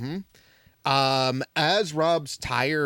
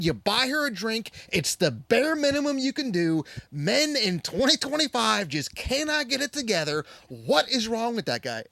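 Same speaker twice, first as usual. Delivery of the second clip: higher mock outraged woman's voice; imitating an indignant woman; 7 semitones higher than usual. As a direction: impersonate her ranting about the drink. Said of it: "she goes".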